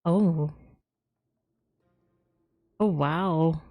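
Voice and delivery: ordinary talk, nothing marked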